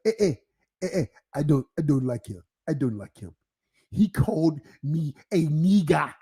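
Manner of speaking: low, heavy African accent, imitating the coworker; hesitant complaint in a put-on African accent; coworker's complaint in a low African accent. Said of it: "I gotta say it like him".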